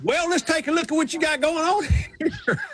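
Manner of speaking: high, hammed-up Southern twang; thick Southern accent. Brain surgeon greeting a patient, exaggerated high Southern accent. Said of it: "there is a little something disconcerting".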